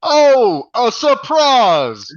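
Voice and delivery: drawn-out, high-pitched mock shout; as loud as possible. Podcaster imitating the movie guy's surprise, as loud as he can. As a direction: belt it out in exaggerated surprise. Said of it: "as loud as he can".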